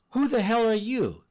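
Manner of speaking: loud, high startled shout at an intruder; angry yell. Retold or imitated. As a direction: bark it out like an old woman catching a trespasser. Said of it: "she yelled".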